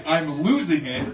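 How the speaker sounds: ordinary talk, nothing marked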